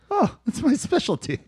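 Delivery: high, soft mock French accent. Retold sex worker answering the spider man, mock French accent. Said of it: "In a French accent".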